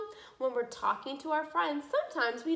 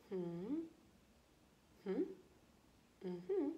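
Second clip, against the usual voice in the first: normal talking voice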